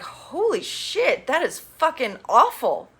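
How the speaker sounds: high, slow horrified 'holy shit'; shocked gasp. Retold or imitated. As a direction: act stunned at the slap. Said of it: "it was like".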